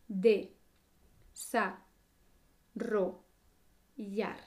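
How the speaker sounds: slow, one syllable at a time. Shown as a demonstration. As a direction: take it slowly, syllable by syllable. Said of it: "sílaba por sílaba y despacio".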